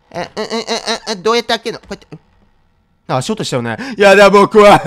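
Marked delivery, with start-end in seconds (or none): 4.0-4.8 scared. Real, not acted.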